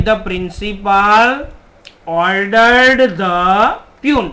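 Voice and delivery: very slow, word by word dictation. Shown as a demonstration. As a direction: spell the sentence out slowly for the class. Teacher dictates the converted sentence. (ધીમે ધીમે લખાવવું)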